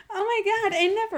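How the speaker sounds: high-pitched imitation of indignation; mock outrage. Acted outrage in retold fight.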